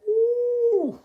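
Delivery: high falsetto ooh of shock; an exaggerated high gasp of disbelief. Real, not acted. Reacting to the quote, a very high shocked ooh.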